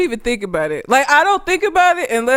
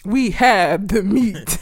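slow, drawn-out ad slogan; imitating a fast-food ad voice; commercial voice-over; much slower than usual. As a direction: stretch it like a commercial announcer. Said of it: "an Arby's commercial".

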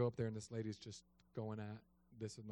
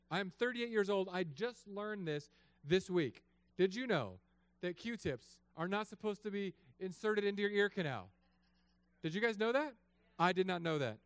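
excited preaching; 8 semitones higher, 6 dB louder than usual. Acted.

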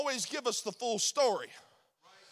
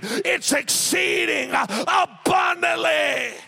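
loud, rising preacher's shout, slowing on the last words; stretched-out shouted climax on abundantly; full-force preaching; 2 semitones higher, 7 dB louder than usual. Acted. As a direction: build the congregation up to a roar.

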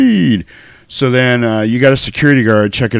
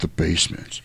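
lower, softer attempted action hero; badly faked low movie-star line; Keanu Reeves; 4 semitones lower, 9 dB quieter than usual. Host trying the cop's preview answer, low soft impression. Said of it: "My voice is too deep to do Keanu Reeves".

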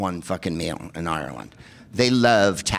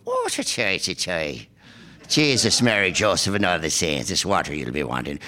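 put-on Irish accent; 2 semitones lower than usual. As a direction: play the twinkly Irish clerk, broad brogue. Irish hotel clerk voiced in story.

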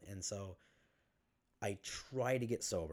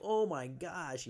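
high, slow put-on amused voice; overly interested fake reaction, much higher and slow; mock fake laugh-along; 9 semitones higher, 7 dB louder, much slower than usual. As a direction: play it up as a forced polite reaction. Mimics himself covering on date.

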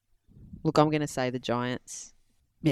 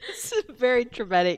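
laughing; 10 semitones higher, 3 dB louder than usual. Real, not acted. Mock distress over nosebleed, laughing.

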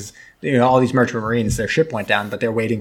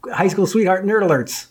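mocking teasing; 8 semitones higher than usual. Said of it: "And I was like".

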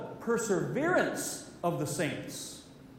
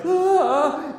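sudden loud cry of pain, pitched up; 8 semitones higher, 10 dB louder than usual. Retold or imitated. King crying out as his arm withers, loud pained yelp. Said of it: "the king is like".

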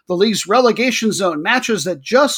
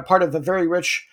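ordinary talk

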